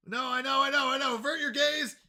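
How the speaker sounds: high, loud mock-dramatic plea; flustered mock plea. Real, not acted. Streamer begging chat not to look, high loud mock plea. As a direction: ham up the panic. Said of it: "the funny part is you don't even have to avert your gaze".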